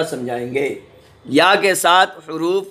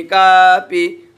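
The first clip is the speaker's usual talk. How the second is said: slow blended word; 3 semitones higher, 5 dB louder, much slower than usual. Shown as a demonstration. Teacher showing pupils the joined word दादी, slow and clear. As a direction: stretch दादी out slowly and a bit louder, syllables joined. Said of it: "काफ ये का".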